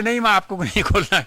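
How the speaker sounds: हँसते हुए जवाब देना; हँसी में शब्द कहो; laughing reply. Retold or imitated. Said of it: "तो बोले". (हँसते हुए, प्यार से मना करना)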